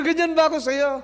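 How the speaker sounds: high, loud disbelief; father asking his son if he is really like that; mock-shocked question. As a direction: play it up as a stunned father. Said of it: "Sabi ko".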